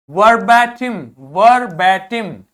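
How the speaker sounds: वर्बैटिम said slowly and loudly; slow, loud model pronunciation. Shown as a demonstration. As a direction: hammer out वर्बैटिम for the class. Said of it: "teacher models word". (धीमा, ज़ोरदार उच्चारण)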